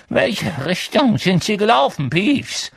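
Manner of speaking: high, loud, agitated demand; Filch. A narrator's reading. Filch questioning Peeves about the runaways, demanding. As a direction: interrogate urgently with a rising end. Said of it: "hörten sie Filch fragen".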